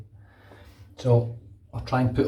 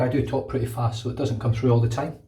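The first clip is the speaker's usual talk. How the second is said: normal talking voice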